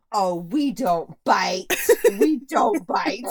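slow, loud imitated scolding; drawn-out pretend-mommy reprimand, rising on the repeat; little girl playing mom. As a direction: correct the baby like a tiny mother. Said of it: "she could be the mom".

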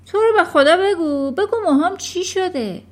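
high, loud anxious plea; alarmed pleading. A narrator's reading. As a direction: beg urgently to be told. Alarmed father begs to be told.

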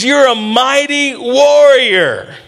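high, slow, stretched-out proclamation; angel's proclamation. Acted. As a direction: proclaim it high, drawing out each word. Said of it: "He says".

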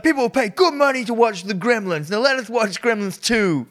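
high, emphatic mock yelling; hammed-up commanding rant, pitched high; Hulk Hogan. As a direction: imitate a wrestler ordering troublemakers around. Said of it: "get Hulk Hogan to yell at the gremlins".

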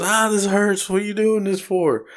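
Daniel Radcliffe's character, lightly voiced, complaining after a punch; mock pained whine. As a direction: whine through the pain, half-joking. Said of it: "He goes".

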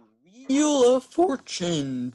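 high, slow announcer voice; mock game show announcer; game show host.

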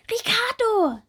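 high, loud call Ricardo. Acted. Bumblebee calling to the butterfly, high loud call. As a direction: call across the meadow. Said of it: "ruft die kleine Hummel".

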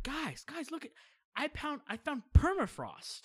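high-pitched, breathless announcement of a big find; excited discovery. Retold or imitated. Kid yelling to parents on the porch, excited. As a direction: call out the amazing find, voice leaping up.

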